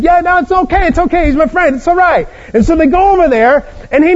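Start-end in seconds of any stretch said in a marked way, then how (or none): 0.0-2.3 higher, airy it's okay, it's okay; breezy reassurance. Acted. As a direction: wave off his friend's worry lightly. Host brushes off friend's worry.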